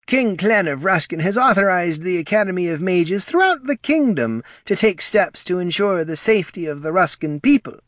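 high-pitched official recitation; reciting. A narrator's reading. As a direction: recite the decree in a high character voice. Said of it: "recited the Counselor of Owls".

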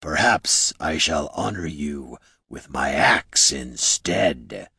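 low, slow threatening rumble; rumbling great orc. A narrator's reading. Orc chief threatening the heroes, low rumble. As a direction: rumble it out with lordly menace. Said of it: "rumbled the great orc".

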